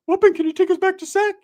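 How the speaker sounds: very high, loud squeaky request; exaggerated high imitation; cousin voice. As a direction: ask it in a goofy high-pitched voice. Primo asks for a ride.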